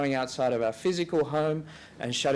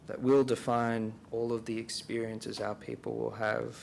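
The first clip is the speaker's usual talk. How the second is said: guided reflection; 3 semitones lower than usual. Real, not acted. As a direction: pose it gently for silent prayer, softening as you go. Leads silent reflection with questions.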